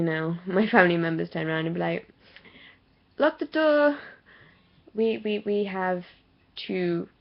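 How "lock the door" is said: loud, high-pitched imitation of a command; mock nagging order. Family member ordering her about, mock nagging. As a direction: call it out like a bossy relative.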